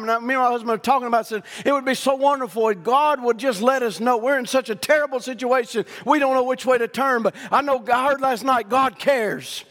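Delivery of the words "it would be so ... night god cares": lightly higher, pleading woman's voice; a hint of a worried wife asking God; voiced woman pleading. Woman's prayer voiced in story.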